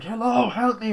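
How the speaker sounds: high pleading cry for help; calling for help. Retold or imitated. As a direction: beg someone upstairs to hear you. Trapped girl calling for help.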